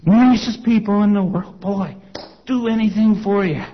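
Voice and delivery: imitated Southern preacher, high and loud; hearty try at an old-time Southern preacher's accent. Preacher praising convention folk, attempted Southern accent. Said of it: "He was an old-time Southern preacher".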